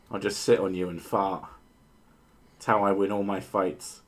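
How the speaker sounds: ordinary talk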